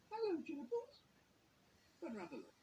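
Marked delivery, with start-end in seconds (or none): 0.1-0.9 put-on very high, cooing little-boy voice; toddler voice. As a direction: coo it like a delighted two-year-old. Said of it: "suddenly I hear".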